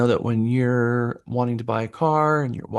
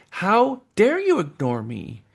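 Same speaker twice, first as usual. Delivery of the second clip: higher, put-on offended buyer; mock indignant; 7 semitones higher, slower than usual. Buyer ignored by the agent, mock indignation. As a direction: play the miffed buyer demanding attention.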